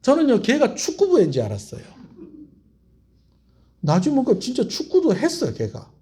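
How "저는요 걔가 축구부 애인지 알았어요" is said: ordinary talk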